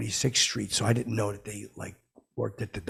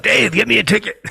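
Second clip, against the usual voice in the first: high, loud heckling yell; full-voiced shouted demand; 12 semitones higher, 13 dB louder than usual. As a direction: holler it across a club mid-show. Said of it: "And I yell out".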